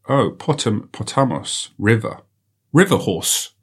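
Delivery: normal talking voice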